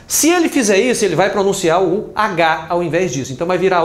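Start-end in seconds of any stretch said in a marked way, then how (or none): none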